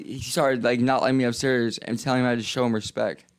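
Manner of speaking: ordinary talk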